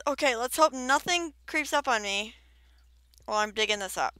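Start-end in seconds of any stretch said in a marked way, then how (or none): none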